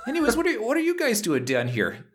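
put-on high, chatty Minnesotan accent; Canadian or Minnesotan accent. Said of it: "slowly morph into Canadian or Minnesotan".

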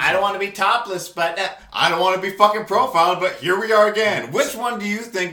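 fed-up outburst; angry rant. Real, not acted. Venting about being profiled, heated.

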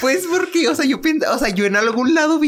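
laughing explanation. Real, not acted. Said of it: "laughing at her mistake".

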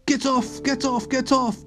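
high shout to get off; yelling in a high child voice. A narrator's reading. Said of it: "Dandy shouted".

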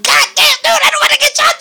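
high, panicky mock plea not to get shot; mock scared. Imitating a scared narco dodging bullets, high panicky. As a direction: squeal it like a scared guy ducking bullets.

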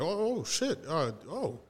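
soft, stammering apology; taken aback. Retold or imitated. Startled coworker apologizing to the man he annoyed, imitated flustered softness. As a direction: back off quietly, caught off guard.

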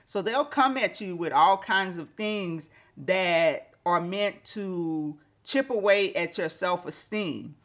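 ordinary talk

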